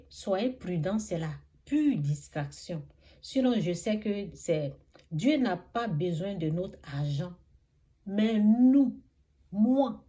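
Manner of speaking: rising, emphatic sermon voice; fervent preaching. Acted. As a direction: preach it out with conviction.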